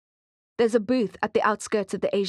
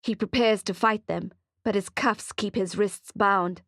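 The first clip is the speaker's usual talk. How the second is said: ordinary delivery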